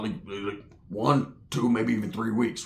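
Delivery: low, quiet trailing mumble; lightly voiced low tweaker counting; quiet low counting to the nephew; [name]'s voice. [name] brags about food.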